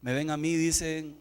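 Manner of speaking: low, soft sermon reflection; quiet reflection. Acted. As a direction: draw the lesson gently.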